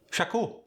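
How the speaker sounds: "shaku", high, extra vowel between consonants; Khmer-accent school. Shown as a demonstration. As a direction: split the opening cluster in "shaku". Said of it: "school might sound like".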